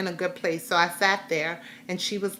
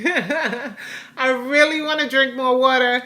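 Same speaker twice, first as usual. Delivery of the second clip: laughing; 5 semitones higher, 4 dB louder, slower than usual. Real, not acted. Laughs at her own resolution.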